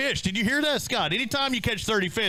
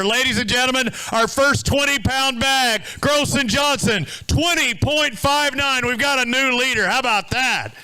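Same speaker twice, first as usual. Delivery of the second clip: tournament announcer; 6 semitones higher than usual. Real, not acted. Weigh-in host tells the crowd of a new leader, announcer voice. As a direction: announce it big, pitched up and stretched out.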